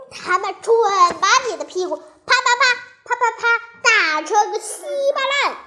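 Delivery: high-pitched, gleeful-sounding storybook threat; taunting threat. A narrator's reading. Parrot warns Maomao of a spanking, taunting. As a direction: rattle off the smacks high and emphatic.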